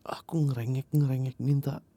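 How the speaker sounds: ordinary delivery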